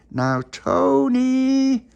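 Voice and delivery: long, high, loud call; shouting through letterbox. Acted. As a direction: yell his name through the letterbox, drawn out.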